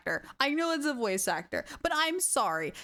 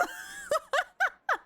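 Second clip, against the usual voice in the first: high squealing laugh. Real, not acted. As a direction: shriek with laughter. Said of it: "my cheeks hurt so much from laughing".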